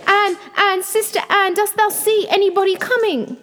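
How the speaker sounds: loud, very high-pitched call; frantic high plea, full voice; desperate crying out. A narrator's reading. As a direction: scream up to your sister for help. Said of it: "and then she cried out".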